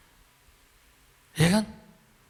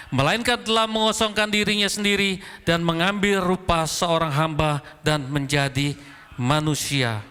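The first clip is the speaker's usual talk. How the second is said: slow, measured reading aloud; congregational scripture reading; 4 semitones higher than usual. Real, not acted. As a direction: lead a congregation reading the verse in unison.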